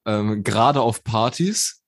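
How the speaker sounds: slow, deliberate quote of the rapper; drawn-out quote. Retold or imitated. Friend retelling a rapper's interview line, slowly drawn out. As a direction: stretch each word out lazily. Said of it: "dann hat er gesagt".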